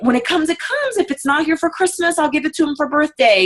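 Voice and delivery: lightly voiced relaxed customer; easygoing customer. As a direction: brush it off, no big deal. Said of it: "They were like".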